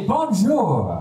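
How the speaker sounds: cocky fake linguist; confident American. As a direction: proudly toss out a French hello. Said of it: "wait two seconds and say".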